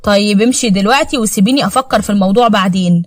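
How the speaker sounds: plain reading voice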